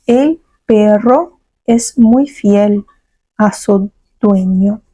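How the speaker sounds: slow, careful dictation pace; slow model sentence. Shown as a demonstration. Teacher to students learning Spanish, slow and clear. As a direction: let the class hear every word, slowly. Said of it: "Quero que vocês repitam".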